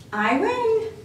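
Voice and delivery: high, gleeful little cheer. A narrator's reading. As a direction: crow about winning. Baba Brana wins.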